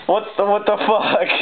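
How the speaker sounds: high, strained what the fuck; can't believe what he's seeing; real shock, pitch jumping up; stunned disbelief. Real, not acted. Second shock at valuable card.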